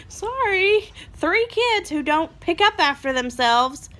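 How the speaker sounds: high, loud, slow sarcastic proclamation; hammed-up public apology; mocking announcer voice. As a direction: announce it to the neighbours, dripping sarcasm. Mock announcement to the world.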